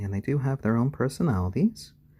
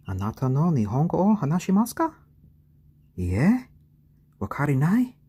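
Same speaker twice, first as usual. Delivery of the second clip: lively, fast, louder Japanese questions; playful Japanese; 7 semitones higher, 5 dB louder than usual. Real, not acted. Testing the chicks' Japanese, bright and quick.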